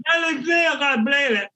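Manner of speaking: high, loud mock sleep-talk calls; hammed-up twin shouting in her sleep. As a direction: call through the wall like a sleepwalker. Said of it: "shouting through the fucking walls".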